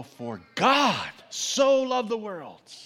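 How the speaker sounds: GOD and SO hit loud and high, drawn out; very slow, booming stress on God and so; emphatic word stress. Shown as a demonstration. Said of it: "by word emphasizing".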